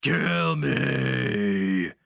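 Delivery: loud roaring challenge; roared. A narrator's reading. Barbarian roars a dare at the sorcerer, loud roar. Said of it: "the barbarian roared".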